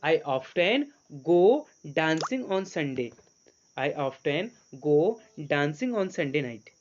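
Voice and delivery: each word drawn out slowly; very slow dictation. Shown as a demonstration. Teacher slowly building an English sentence for students. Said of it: "sentence को set करने की कोशिश".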